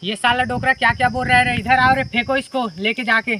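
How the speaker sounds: high, loud angry command; angry order. Acted. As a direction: order the old man thrown out, furious. साहब गुस्से में हटाने का हुक्म. (गुस्से में ऊँचा, कड़क हुक्म)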